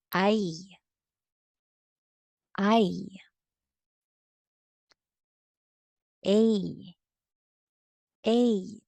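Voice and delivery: "ai", "Ei", "Oi" each gliding from open vowel to i, crisp; model drill syllables. Shown as a demonstration. Teacher models Spanish diphthongs twice each for students. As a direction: model each falling diphthong clearly for repeating. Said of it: "please repeat after me".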